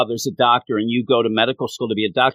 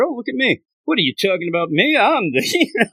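mock boast; 8 semitones higher than usual. Mocking Hina's boastful last-chapter self.

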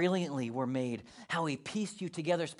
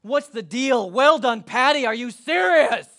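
exasperated God; 5 semitones higher, 10 dB louder, slower than usual. Pretend. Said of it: "He's not saying".

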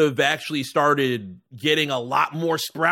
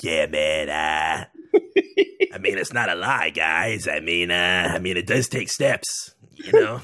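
deep, lowered mock coach voice; Budenholzer impression; 8 semitones lower than usual. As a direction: drop way down and play the sheepish coach. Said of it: "I assume Budenholzer is like".

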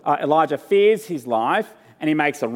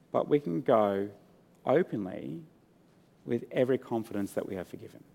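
ordinary delivery

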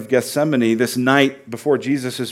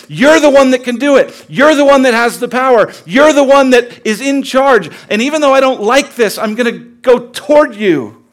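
louder and higher, pressing God; loud honest prayer; 10 semitones higher, 9 dB louder than usual. Acted. Believer crying out to God in frustration, loud and pressing. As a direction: push your frustration at God while trusting.

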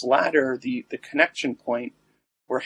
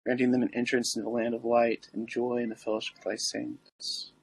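prayer; 4 dB quieter than usual. Acted. Recites the litany.

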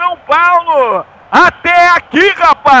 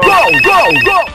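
goal scream; 4 semitones higher than usual. Real, not acted.

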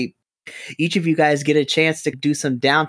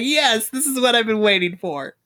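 high, gleeful cheer; finally, the moment you waited for; excited; 7 semitones higher than usual. Retold or imitated. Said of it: "I was like".

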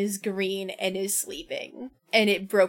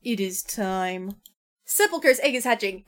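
normal talking voice

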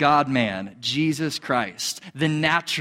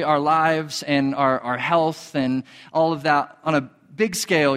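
ordinary talk

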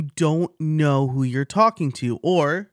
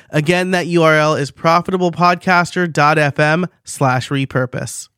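energetic, punchy webinar pitch; lively podcast ad read; promo read; 3 semitones higher, 5 dB louder than usual. Real, not acted. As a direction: read it as a scripted ad, driving the key words.

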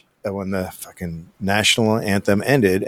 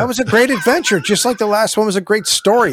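higher, sing-song fake cheer; mock enthusiasm; 8 semitones higher than usual. Mocking the book's ending for co-hosts, sing-song fake cheer. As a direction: oversell it like a cheesy narrator. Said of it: "mocking the book's praise".

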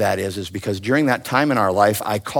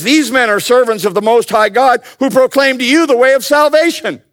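preaching voice; 13 semitones higher, 7 dB louder than usual. Acted. Preaching the verse.

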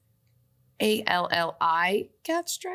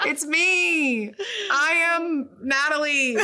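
laughing; 8 semitones higher, slower than usual. Real, not acted. Laughing at own alias.

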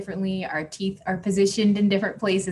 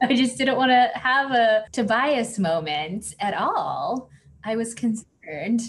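laughing; 6 semitones higher than usual. Real, not acted. Joking about being dyed pink.

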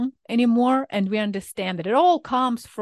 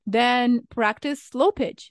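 normal talking voice

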